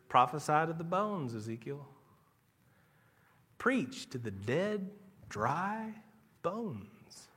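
low, hushed voice of God; a soft, deep divine command. Retold or imitated. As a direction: give the command low and grave.

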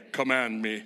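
very low, deferential butler voice. Acted. Trained kid reporting to parents like butler, low deadpan. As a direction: present yourself like a dutiful butler, low. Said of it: "like I'm a butler".

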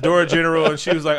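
ordinary talk

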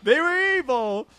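high, slow hammy proclamation; drawn out hammed-up doom; mock dramatic voice. Quoting reviewer's line.

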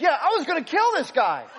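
high loud shout with a laugh. Retold or imitated. Angry fan laughs with friends, loud high shout. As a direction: laugh it off loudly. Big guy answers, laughing.

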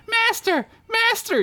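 put-on very high, breathless call of a young boy; yelling boy. Breathless servant boy calling his master. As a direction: shout for the master in a squeaky boy voice. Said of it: "yelled another boy".

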